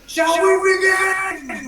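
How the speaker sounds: high, slow, loud theatrical shout; dramatic challenge. Shown as a demonstration. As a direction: call it out slowly to the whole arena. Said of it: "I'm like".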